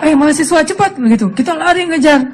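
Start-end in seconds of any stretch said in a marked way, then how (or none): 0.0-1.0 mock driver taunt. Bus driver taunting students.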